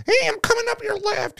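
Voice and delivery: put-on very high, squeaky kid's voice; mocking a little kid on a game headset; squeaky kid gamer. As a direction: play a preteen calling out on Call of Duty. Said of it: "these kids on there and they're like".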